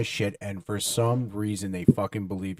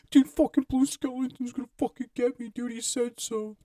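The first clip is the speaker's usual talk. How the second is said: much higher, frantic voice, slowing and fading at the end; panicked whining; 14 semitones higher than usual. Retold or imitated. Friend bursting into the room after the call, high panicky voice. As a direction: play a freaked-out kid sure he's being hunted. Terrified friend runs back in.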